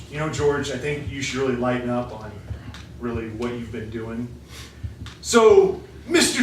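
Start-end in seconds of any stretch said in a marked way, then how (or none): 0.1-4.3 softer, gentle advice to the king; calm plea. Acted. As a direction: quietly urge him to lighten up. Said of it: "and said".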